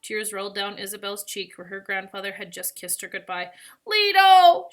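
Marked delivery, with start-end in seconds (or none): 3.8-4.7 high, loud drawn-out call; anguished shout of a name; crying out. A narrator's reading. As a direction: call desperately after someone. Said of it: "she cried again".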